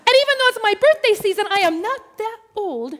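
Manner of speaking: high, loud, mock-offended insistence; indignant protest. Swimmer offended at being called ma'am, indignant protest.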